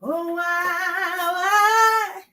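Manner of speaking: high, drawn-out singsong lament; sung-out cry of dismay; dismayed wail. Real, not acted. Dismayed at accidentally pulling the plug.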